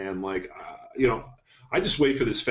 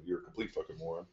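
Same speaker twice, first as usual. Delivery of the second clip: soft, quick, deadpan insult, much quieter; mock nice version; 3 semitones lower, 13 dB quieter, faster than usual. Coach showing his "nicer" way of calling clients morons, soft quick deadpan. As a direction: toss off the insult softly and quickly, like it's polite. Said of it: "Normally I say it in a nicer way".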